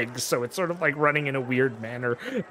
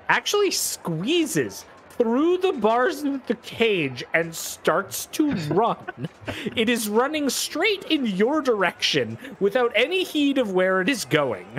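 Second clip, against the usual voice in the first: excited narration; 11 semitones higher, 4 dB louder, slower than usual. A narrator's reading. Goblin escapes, narrator excited.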